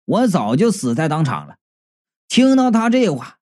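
usual speaking voice

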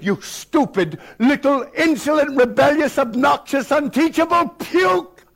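high shouted insults, slowing at the end; furious scream. Retold or imitated. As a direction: spit each insult in rage. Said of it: "he began to scream".

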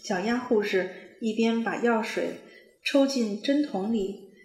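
ordinary delivery, nothing marked

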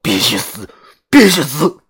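repeated war cry, jumping high and loud; escalating furious chant; angry retort. Acted. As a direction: build to a high yell. Fan yelling back in quarrel.

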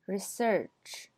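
research stressed on SEARCH, falling; verb stress. Shown as a demonstration. Teacher repeats the verb, stress on SEARCH. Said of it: "后边是动词".

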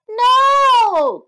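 high, loud cry of no; old lady crying out no; cried out. Acted. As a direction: cry out in alarm. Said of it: "cried the old lady".

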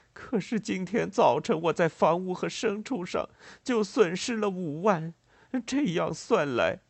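high, strained voice of bitter despair; acted, disappointed outcry; despairing cry. A narrator's reading. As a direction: wail it out in despair. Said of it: "失望地叫道".

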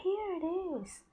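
bright high reveal; delighted discovery for a child; reading to a toddler. Acted. Mother reveals mouse in book.

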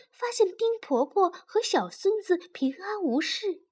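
plain reading voice

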